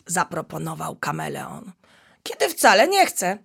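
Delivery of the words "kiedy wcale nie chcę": higher, louder indignant denial; lion cub protesting he doesn't need the toilet. Acted. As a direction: object firmly like a proud child. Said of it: "zaprotestował Lewek".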